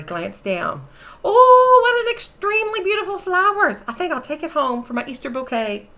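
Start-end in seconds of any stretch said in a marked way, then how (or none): 1.2-5.9 put-on high, loud, delighted old lady; gushing lady. Mabel Mayberry gushing over the daffodil in a high voice. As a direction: swoon over the lovely flower.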